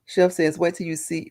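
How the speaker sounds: normal talking voice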